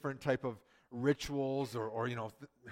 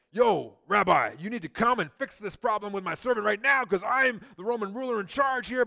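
bossy Roman ruler; 6 semitones higher, 8 dB louder than usual. Pretend. Preacher voicing a pushy Roman ruler, loud and demanding. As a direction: boss the rabbi around like the ruler in charge.

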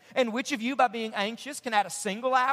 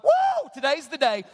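mock hype; 3 semitones higher, 4 dB louder than usual. Pastor whooping to fire up the congregation. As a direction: cheer like you're fired up. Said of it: "you're so fired up".

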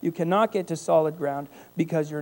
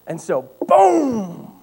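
ordinary talk, nothing marked

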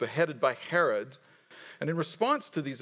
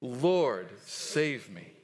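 measured, lower recitation, dropping at the end; leading group recitation; 4 semitones lower, much slower than usual. Shown as a demonstration. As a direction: say it evenly so everyone can join. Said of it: "Let's say that together".

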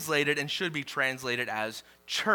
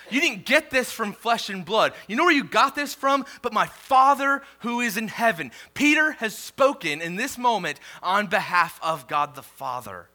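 loud, higher, driving question to the room; preaching at full force; 7 semitones higher, 7 dB louder than usual. Acted. Pastor challenging the congregation on revelation, loud preaching. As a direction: hammer the point home from the pulpit. Preacher presses the point home.

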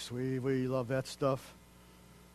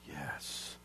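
usual speaking voice

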